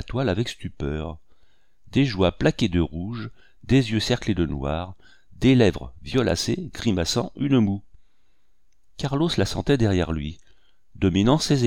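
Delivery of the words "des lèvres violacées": ordinary delivery, nothing marked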